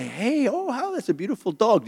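high, soft coo over a dog; friendly approach. Retold or imitated. Greeting a stranger out walking their dog, in a high, soft coo.

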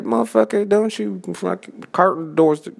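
mock outburst. Venting about Carlton on the show, mock outraged outburst. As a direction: pitch jumps up in fake outrage. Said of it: "It's like".

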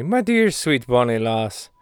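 slow mock Irish lilt on my dear sweet bonnie lass; a hint of Irish, slow and fond; Irish accent. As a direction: lay on a light Irish brogue, drawn out slowly. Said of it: "so you get that I'm speaking Irishly".

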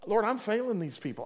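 ordinary delivery

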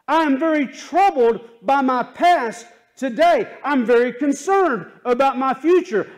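high, loud pleading sermon cry; impassioned preacher cry for hope; fervent preaching. Acted. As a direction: plead as a searching soul, full force. Preaching at full force, voicing seeker.

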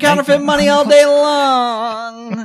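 hammed-up twenties bullhorn barker; old-timey barker. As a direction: bark it like a twenties street pitchman. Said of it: "the old bullhorn".

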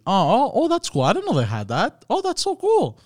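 high-pitched, swooping squeal of delight; excited delight. Real, not acted. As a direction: gush over a cool discovery. Delighted at discovering an emoji.

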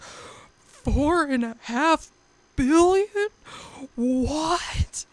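high, stretched, incredulous; stunned disbelief. Retold or imitated. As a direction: gasp it out in disbelief. Stunned at Earth's age.

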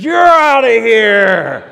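high, loud, slow shout; ejecting shout. Retold or imitated. Sensitive ref ejecting the pastor, booming high shout. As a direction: throw him out of the gym with one big yell. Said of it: "And he says".

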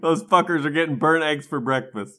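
loud words broken by laughter; laughing through it. Real, not acted. Co-host declaring her quiz answer, laughing loudly. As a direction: say it while cracking up.

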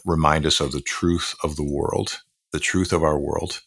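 usual speaking voice

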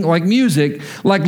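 ordinary talk, nothing marked

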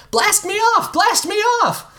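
put-on very high squealing boy voice; excited kid. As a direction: bounce with boyish glee. Kid chanting demand.

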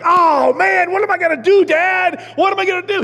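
high, loud panicked whining; panicky whine. Retold or imitated. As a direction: squeal like a frightened kid to Dad. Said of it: "He didn't be like".

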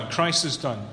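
usual speaking voice

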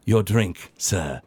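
understated servant's voice, dropping low; Brutus Payne. Acted. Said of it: "Brutus Payne set a drink down".

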